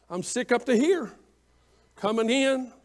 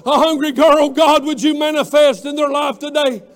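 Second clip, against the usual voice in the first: full-force preaching; 3 semitones higher, 6 dB louder than usual. Real, not acted. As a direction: drive the congregation to their feet. Preacher leads rousing altar call.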